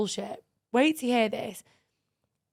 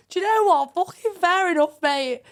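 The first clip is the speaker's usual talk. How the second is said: higher, louder amused approval; grudgingly impressed; 6 semitones higher, 5 dB louder than usual. Retold or imitated. About the French guy's effort, loud amused approval. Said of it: "I was like".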